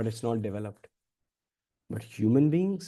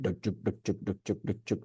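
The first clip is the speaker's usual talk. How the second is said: soft rhythmic thumping noise imitation; imitated music beat; 4 semitones lower, 9 dB quieter, faster than usual. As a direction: mimic a muffled beat, quietly and evenly. Imitating thumping music in others' earphones.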